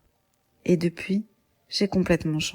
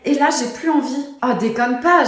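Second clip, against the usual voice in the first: panicked; 4 semitones higher, 5 dB louder than usual. Acted. Mariée qui panique.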